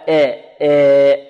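long eh-like آ; wrong tilted vowel. Shown as a demonstration. Teacher shows the other error, imala. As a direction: say آ bent toward eh. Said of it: "ولا".

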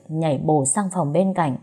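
plain reading voice

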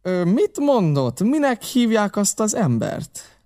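high, slow feigned puzzlement; stretched, high-pitched querying voice; probing innkeeper Silver. Acted. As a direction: draw the question out slowly. Silver questions the boy.

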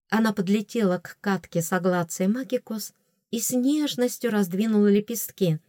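plain reading voice

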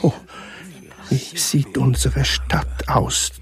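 normal talking voice

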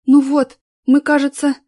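breathless, loud after running; out of breath. A narrator's reading. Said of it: "прерывающимся от быстрого бега голосом".

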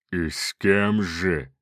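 slow, low sneering demon voice; mocking demon. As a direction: sneer at his boast. Demon mocks the aristocrat.

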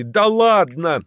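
high, slow yelled disbelief; yelling in unison. A narrator's reading. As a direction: howl it out together, amazed. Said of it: "в один голос завопили".

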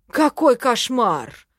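mock horror, slow and loud; theatrical exclamation. A narrator's reading. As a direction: play up the horror, stretching the words. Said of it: "воскликнул Хорёк, наигранно схватившись за сердце".

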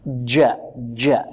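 low, soft جاء coloured by a d; jim tinged with dal. Shown as a demonstration. Teacher imitating pupils who mix jim with dal, faulty jim. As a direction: say جاء with the tongue near the tip, like dj. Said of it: "قريبة من طرف اللسان".

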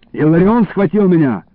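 ordinary delivery

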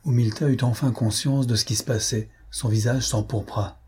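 ordinary delivery

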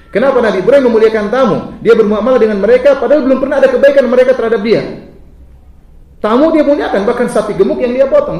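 loud, high preaching. Acted. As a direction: lift the room with fervour. Preaching at full force. (khotbah lantang dan tinggi)

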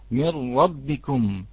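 "من ربكم" said slowly, the nun merged fully into a doubled r; mirr-rabbikum, a slow heavy doubled r; full assimilation demo. Shown as a demonstration. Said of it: "نلاحظ كيف الإدغام".

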